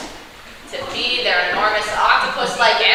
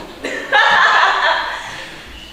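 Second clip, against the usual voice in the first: laughing; 8 semitones higher than usual. Real, not acted. Laugh alone on line.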